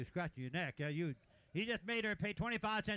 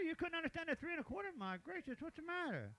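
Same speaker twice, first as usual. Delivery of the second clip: ordinary delivery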